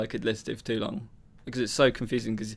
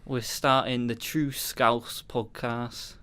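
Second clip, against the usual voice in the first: slow, stretched stab at Scouse; mock Scouse podcast host; attempted Scouse accent. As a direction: have a shaky go at a Scouse intro. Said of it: "was that even a Scouse accent".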